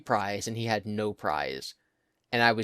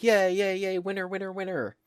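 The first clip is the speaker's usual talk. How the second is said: mock machine cheer; 9 semitones higher than usual. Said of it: "they all start blinking and going like".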